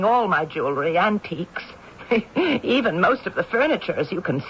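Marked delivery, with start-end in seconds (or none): 2.1-2.6 short rueful laugh, high; a brittle laugh at her own ruin; bitter laugh. Acted. Old woman to her lawyer, a short bitter laugh. Spinster showing her sold-off home.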